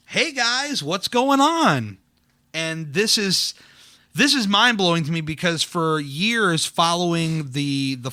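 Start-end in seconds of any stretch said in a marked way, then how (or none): 0.1-2.0 high, drawn-out mock hello; big sing-song hail, stretched out; cheery greeting. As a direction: imitate an upbeat surprise greeting. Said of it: "he is just like".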